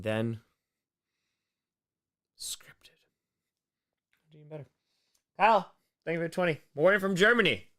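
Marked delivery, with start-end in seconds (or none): 2.4-4.7 whispered aside. Real, not acted.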